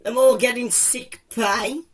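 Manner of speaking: normal talking voice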